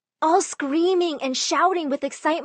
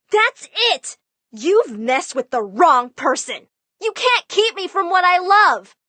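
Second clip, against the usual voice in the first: much higher, louder angry cry; furious outburst; 7 semitones higher, 4 dB louder than usual. Retold or imitated. Daughter to her family after the smashing, furious. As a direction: explode in defiance.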